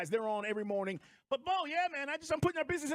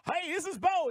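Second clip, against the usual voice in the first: imitating Bo; 6 semitones higher, 4 dB louder than usual. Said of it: "It doesn't sound like that".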